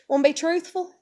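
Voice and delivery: high, loud, condescending questioning; sarcastic challenge. Real, not acted. Challenging preachers who want truth, loudly sarcastic. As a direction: sweetly taunt them, pitch and volume up.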